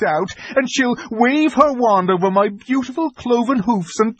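high, crying faun voice; a frightened faun near tears; tearful faun. A narrator's reading. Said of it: "beginning to cry again".

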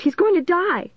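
very high panicked wail; frantic high cry; shrieking. A narrator's reading. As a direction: shriek it in terror. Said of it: "she shrieked".

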